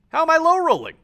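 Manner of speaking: high, loud disbelieving whine; exasperated. Real, not acted. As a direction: player fed up with dice, high exasperated cry. Frustrated at repeated low rolls.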